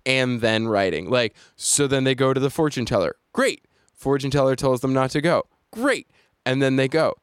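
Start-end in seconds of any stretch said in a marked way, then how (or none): 3.3-3.6 dry, mocking high "Great"; sarcastic great. Sarcastic refrain after plot. 5.7-6.1 dry, mocking high "Great"; sarcastic great. Sarcastic refrain after plot.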